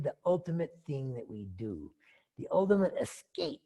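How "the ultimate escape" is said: ordinary talk, nothing marked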